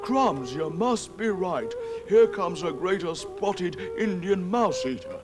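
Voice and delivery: high, slow squeaky alarm; alarmed cry. Acted. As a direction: cry out in fright. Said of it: "he cried".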